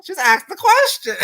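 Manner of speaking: high squealing voice collapsing into laughter; laughing hard. Real, not acted. Reactor defending the shady question, laughing hard. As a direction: squeal it out and crack up. Laughing again at the repeated punchline.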